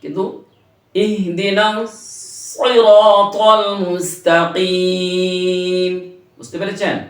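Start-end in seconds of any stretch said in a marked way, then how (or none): 0.9-6.1 সিরাতাল with a pressed, heavy emphatic s; সিরাতাল said slowly, the s pushed down and thick; heavy emphatic s in সিরাতাল, slow recitation; heavy sad sound. Shown as a demonstration. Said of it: "একটু দাবিয়ে বলতে হবে".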